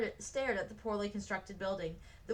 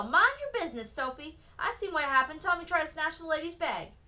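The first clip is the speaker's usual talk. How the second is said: yelling; 7 semitones higher, 6 dB louder than usual. A narrator's reading. Window woman yells at Sophie.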